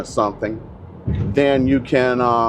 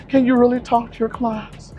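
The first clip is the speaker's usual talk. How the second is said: high falsetto whiny plea; mock crying agent; 12 semitones higher than usual. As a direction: imitate a pleading agent, pitched way up. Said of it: "literally crying".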